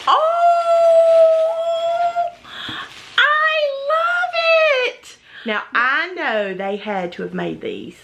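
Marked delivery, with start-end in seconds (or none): 0.1-5.2 overjoyed squeal. Real, not acted. Thanking friends for a handmade ornament, squealing. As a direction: squeal with real delight.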